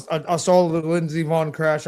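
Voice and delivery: ordinary delivery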